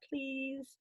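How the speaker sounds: a quiet pleading please; soft plea. Acted. Princess begging her tutor, soft plea. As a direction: beg him gently, almost breathless.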